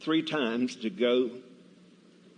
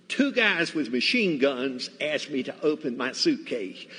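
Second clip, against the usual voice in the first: ordinary delivery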